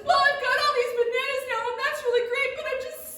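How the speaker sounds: high, loud, wailing lonely; whiny dragonfly. Acted. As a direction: stretch lonely into a sung wail.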